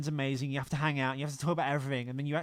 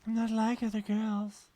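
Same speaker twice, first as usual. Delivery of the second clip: higher, slow, put-on girly voice; mock girly cliché; 7 semitones higher, slower than usual. Teasing friend parodies girls, prissy and slow. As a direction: sell the cliché with a prissy lilt.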